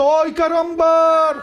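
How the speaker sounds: put-on slow loud Spanish-accent shout of oi, caramba; Spanish-accent caricature. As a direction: ham it up as a Latin character. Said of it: "je vois Roberto Papel comme ça".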